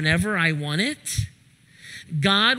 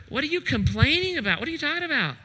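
exasperated; 8 semitones higher, much faster than usual. Acted. Preacher as Jesus exasperated.

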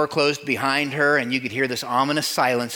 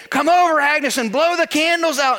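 much higher, louder gruff cook voice; awkward Harry; 11 semitones higher, 5 dB louder than usual. Acted. Diner owner urging Agnes to the cake, awkward and loud. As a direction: push through the awkwardness loudly. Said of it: "in a moment of uncomfortability".

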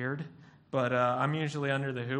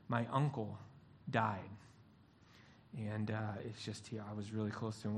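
soft, halting grief over uncle; lightly voiced mourner, trailing away; quiet grief; 5 semitones lower, 7 dB quieter than usual. Grieving pastor tells friend his uncle died, soft and halting.

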